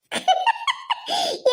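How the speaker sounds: soft high-pitched giggle; high, gleeful chuckle; gloating laugh. Acted. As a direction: giggle to yourself with glee. Gloating over the sister-in-law's loss.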